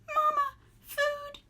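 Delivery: very high, slow chick plea; gosling voice. A narrator's reading. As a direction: plead like a tiny hungry chick. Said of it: "the gosling said".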